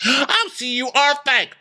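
high-pitched, gasping-offence retort; mock indignant. Sutton catching Diana being fake, mock indignant. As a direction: pounce on it, high and scandalised.

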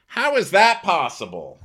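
high, loud incredulous question; comically astonished, voice jumping up; mock disbelief. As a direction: blurt it in baffled disbelief, near laughter. Mock-baffled question with a laugh.

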